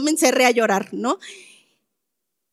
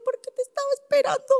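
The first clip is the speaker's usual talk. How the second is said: put-on her teenage self answering much higher and quick; young self, much higher; 12 semitones higher, 5 dB quieter, much faster than usual. Daughter explaining to her father that she waited for him, high and hurt. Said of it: "Le digo".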